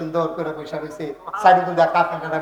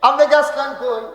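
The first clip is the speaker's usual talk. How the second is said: raised, loud questioning call; 8 semitones higher, 7 dB louder than usual. Acted. Abu Bakr asks where the Prophet went, loud call. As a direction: demand it loudly, pitched up. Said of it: "ডাক দিয়া কয়".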